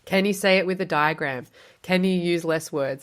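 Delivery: usual speaking voice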